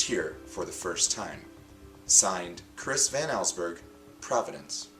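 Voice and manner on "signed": usual speaking voice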